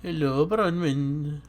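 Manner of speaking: put-on Welsh accent. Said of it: "change to a Welsh voice when I say Bronwyn".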